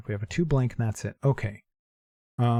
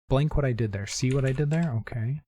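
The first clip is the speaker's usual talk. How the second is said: ordinary delivery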